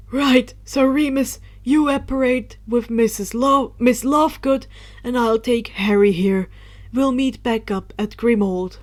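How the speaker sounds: put-on loud scolding old wizard voice; stern exclaim. Dumbledore scolding Luna for wandering off, loud stern voice. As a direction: scold her loudly, relieved and cross.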